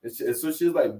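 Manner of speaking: normal talking voice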